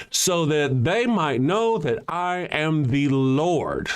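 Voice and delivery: slow, drawn-out scripture reading; lightly dramatic biblical reading. Acted. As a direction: read it like a grand decree, slowly. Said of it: "So it said".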